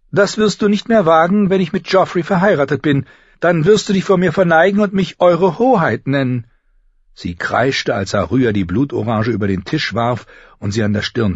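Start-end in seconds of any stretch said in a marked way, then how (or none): 0.1-6.5 put-on high, loud, self-important girl; haughty older sister. Future queen warns her sister to bow, haughty. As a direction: lord it over your sister in a high voice.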